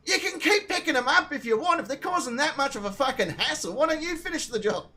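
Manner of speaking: high, indignant goading; retold sarcastic challenge. Retold taunt in an argument.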